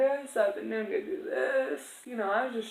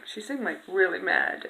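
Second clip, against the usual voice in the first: ordinary talk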